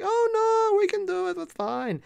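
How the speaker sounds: put-on light high German accent; mock German in-laws, high and light. Future in-laws offering to help, light German accent. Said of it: "I gave them a German accent".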